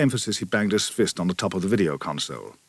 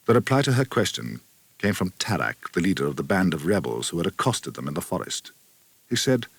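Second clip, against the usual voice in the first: ordinary delivery, nothing marked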